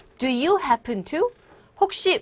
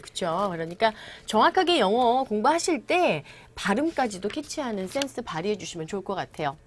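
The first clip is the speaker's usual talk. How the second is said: ordinary talk, nothing marked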